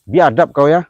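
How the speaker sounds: yelling at the spirit; angry shout. Real, not acted. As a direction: spit the insult out.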